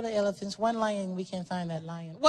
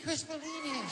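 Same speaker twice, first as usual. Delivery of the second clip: high, much softer [name] with a laugh; [name] softly; 6 semitones higher, 6 dB quieter than usual. Retold or imitated. [name] repeating to Chris, high and hushed. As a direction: let it fall away to a giggly hush. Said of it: "Michael was getting mad".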